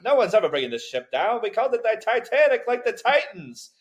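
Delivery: put-on high, loud, proud old-timey boast; boastful shipbuilder. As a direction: brag like a shipowner. Mock Titanic builder boasting.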